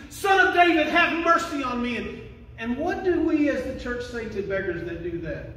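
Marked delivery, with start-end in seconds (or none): 0.1-2.0 loud, raised-pitch acted plea; crying out. Beggar cries out to a powerful figure, shouted plea. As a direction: shout it high, easing a little by the end. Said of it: "He cries out to Jesus".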